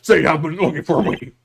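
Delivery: booming, over-the-top bluster, high and loud at the start; pompous big-man lawyer. Acted. Lawyer greets the young man looking for him, booming pompously. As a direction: play a grandiose old attorney.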